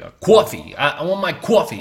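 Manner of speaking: mock "coffee" with a broad "kwaw" vowel; New York coffee. Imitating Americans ordering, "coffee" said "kwawrfee". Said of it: "the way they say coffee".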